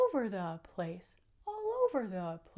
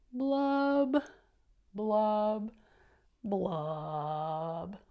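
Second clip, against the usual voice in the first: fish blub sounds; 4 dB louder, much slower than usual. Acted. Fish blubbing again.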